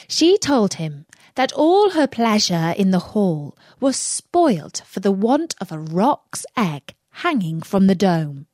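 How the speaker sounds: ordinary delivery, nothing marked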